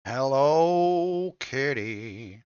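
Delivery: very slow, savored "Hello Kitty"; drawn-out "Hello Kitty". Acted. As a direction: stretch "Hello Kitty" out slowly. Savoring the name.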